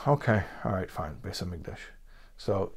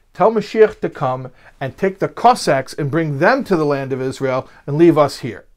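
mock demand; 4 semitones higher, 8 dB louder than usual. Wife's demand retold.

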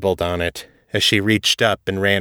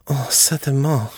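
French maid agreeing; 4 semitones higher than usual. A narrator's reading.

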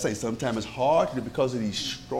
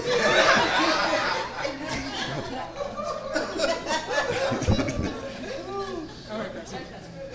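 long, high cackle of laughter; 4 dB quieter than usual. Real, not acted. Laughing at joke.

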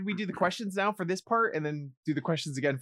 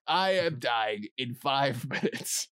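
slow, lower, drawn-out deathbed voice; a hammed-up farewell from the deathbed; mock dying announcement; 7 semitones lower, 4 dB quieter, slower than usual. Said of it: "I'm gonna be like".

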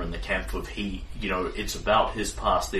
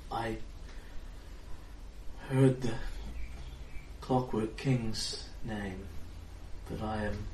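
tired distant fairy queen; 5 dB quieter than usual. Acted. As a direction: speak as a weary queen drifting off, far away. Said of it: "She sounds very tired and very far away".

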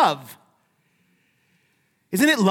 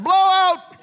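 loud, much higher shout; yelled alarm; 11 semitones higher, 6 dB louder than usual. Retold or imitated. Wife yelling about baby's diaper, loud and high. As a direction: sound the alarm. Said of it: "My wife used to yell that".

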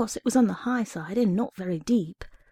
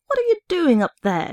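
much higher, louder angry shout; 9 semitones higher, 5 dB louder than usual. A narrator's reading. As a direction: bark it up at the boy on the shelf. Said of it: "he shouted in an angry voice".

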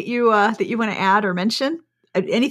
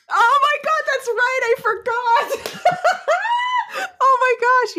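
high, laughing "oh my God, I forgot"; laughing surprise. Real, not acted. Hosts remind her of the Oprah list, she laughs high. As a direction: burst into delighted laughter. Suddenly remembers Oprah certification.

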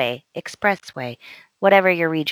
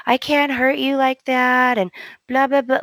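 mock lament; 6 semitones higher than usual. Steffi going on and on to Finn, mock lament. Mimicking Steffi's endless anguished speech.

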